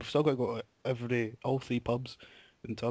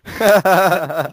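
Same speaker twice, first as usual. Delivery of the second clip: laughing; 8 semitones higher, 15 dB louder than usual. Real, not acted. Laughing at the engine cutting.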